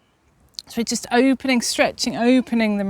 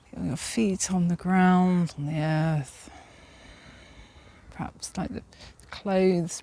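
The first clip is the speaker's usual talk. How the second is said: calm grounding exercise; 2 semitones lower, 5 dB quieter than usual. Acted. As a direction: lead them into stillness gently. Guides grounding meditation.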